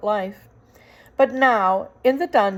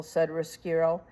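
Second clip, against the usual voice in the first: ordinary delivery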